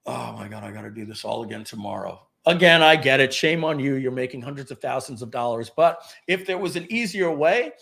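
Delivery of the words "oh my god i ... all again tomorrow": worn-out sigh. Retold or imitated. End of exhausting launch day.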